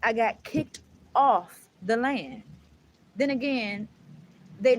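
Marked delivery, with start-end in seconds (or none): 0.0-2.4 emphatic. Real, not acted. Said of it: "repeated louder".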